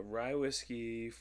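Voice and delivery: usual speaking voice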